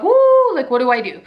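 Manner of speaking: startled cry. Retold or imitated. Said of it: "And I'm like".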